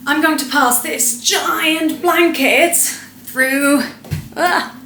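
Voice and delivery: high, slow build-up to a trick; showy presenter. Acted. Presenter passing a giant blanket through a tiny space, showy.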